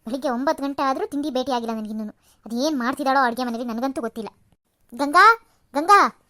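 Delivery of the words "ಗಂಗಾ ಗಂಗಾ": long, slow shouted call across the house. Acted. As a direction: drag out the name and call her loudly. Mother-in-law calls daughter-in-law. (ಮನೆಯೊಳಗೆ ಕೂಗಿ ದೀರ್ಘವಾಗಿ ಕರೆಯುವುದು)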